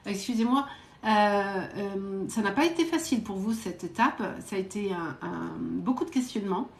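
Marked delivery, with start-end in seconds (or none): none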